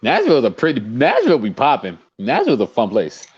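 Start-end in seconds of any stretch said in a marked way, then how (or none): none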